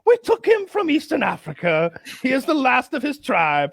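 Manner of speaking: mock-solemn colonial showman; mock exhibit announcer. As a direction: present him like a circus barker.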